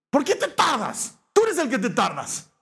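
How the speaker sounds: high, slowed, indignant comeback; mock indignant whine; defensive retort. Saul snaps back.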